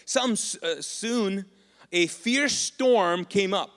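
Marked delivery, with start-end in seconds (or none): 1.9-3.7 dramatic build-up. A narrator's reading. Dramatic reading of the storm.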